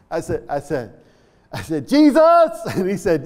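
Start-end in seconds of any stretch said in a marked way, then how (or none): 1.9-2.2 high, loud shout into his ear; yelled prayer. Retold or imitated. Preacher yelling a prayer into an old man's ear. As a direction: yell the name so a deaf man hears. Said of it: "I had to yell the prayer to him".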